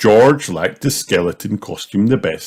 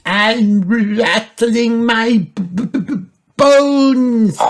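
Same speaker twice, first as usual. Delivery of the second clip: high, slow stutter bursting into a loud last word; rattling skeleton; 5 dB louder, much slower than usual. A narrator's reading. Peppa shaking in a skeleton costume, high stuttering rattle. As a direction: rattle the b's, then release the word. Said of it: "shaking her arms and legs".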